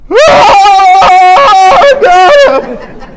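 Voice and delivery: loud, high cry of "Oh, God". Retold or imitated. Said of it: "I remember crying like".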